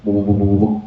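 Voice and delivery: low bubbling gargle noises; bubbling mouthwash sounds; gargle imitation. Shown as a demonstration. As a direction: mimic swishing water in the mouth. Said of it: "pengumumin kumur-kumur aja".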